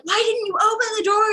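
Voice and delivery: high, exasperated mock demand; upset driver. Driver scolding passenger who threw up, high mock upset. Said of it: "the driver just was very upset".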